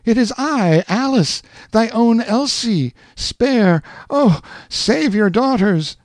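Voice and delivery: put-on high desperate pleading girl; desperate daughter. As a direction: plead to be saved. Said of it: "a piercing cry from out of the mist".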